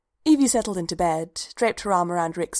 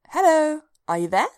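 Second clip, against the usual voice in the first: high, louder hello to get attention; calling out; 10 semitones higher, 6 dB louder than usual. A narrator's reading. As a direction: call to someone who is not responding. Calls to unresponsive man.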